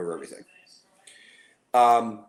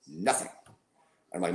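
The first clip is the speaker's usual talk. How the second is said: ordinary talk, nothing marked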